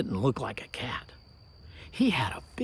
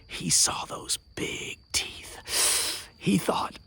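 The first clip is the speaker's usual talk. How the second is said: usual speaking voice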